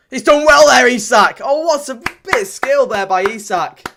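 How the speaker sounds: excited shout. Real, not acted. Fan thrilled by striker's skill, excited shout. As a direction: burst out in delight. Star striker beats defenders.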